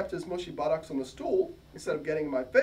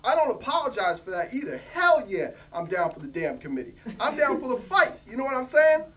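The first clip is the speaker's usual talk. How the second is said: louder, punchy pushback; defiant retort; 3 semitones higher, 6 dB louder than usual. Acted. New hire pushing back at his rival, loud and defiant. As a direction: stand your ground and hit back hard. Mosaic defends himself, defiant.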